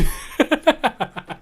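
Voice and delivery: high-pitched burst of laughter; laughing. Real, not acted. Laughing after proposing the draft review.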